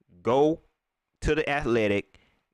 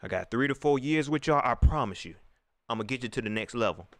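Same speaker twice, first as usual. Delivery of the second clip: lower, mocking coach pep talk; a lower mock of the coach promising the world; 9 semitones lower than usual.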